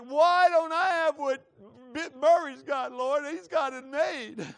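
very loud, high mock wail; hammed-up, drawn-out envious whine; whining and crying. Trash collector compares himself to another before God, wailing complaint. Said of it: "I'm whining and crying".

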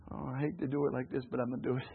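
half-ashamed excuse under the breath; reluctant mutter. Pretend. Preacher mimicking a churchgoer making excuses, softly grudging.

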